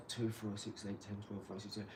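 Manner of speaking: muttered count under the breath; quiet counting aside; counting to himself. Real, not acted. Counting bricks under his breath.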